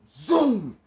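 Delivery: long, slow, loud vroom-like zoom; drawn-out car zoom. Retold or imitated. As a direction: stretch it like a car shooting off. Imitates the car taking off.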